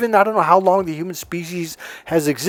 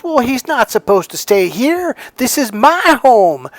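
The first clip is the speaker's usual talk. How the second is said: high, indignant Southern woman impression; Southern accent; 9 semitones higher, 5 dB louder than usual. Older woman insisting the house is hers, Southern accent. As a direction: imitate a Southern lady staking her claim. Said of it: "She had a southern accent".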